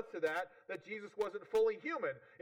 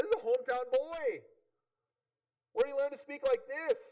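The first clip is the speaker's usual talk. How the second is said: higher, louder voice of hometown disbelief; amazed townspeople; 7 semitones higher, 6 dB louder than usual. Acted. Hometown people looking at this guy, amazed and disbelieving. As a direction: play an astonished local, pitched up and loud. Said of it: "they're amazed".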